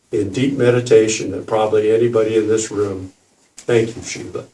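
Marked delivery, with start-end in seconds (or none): 3.6-4.5 soft breathy thanks; wry whisper. Real, not acted. Thanking Shiva to the room, wry whisper.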